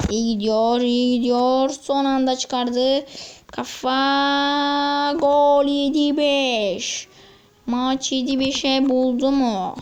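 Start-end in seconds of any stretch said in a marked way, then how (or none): 3.5-7.1 screaming football commentator. Pretend. Calls goal like commentator.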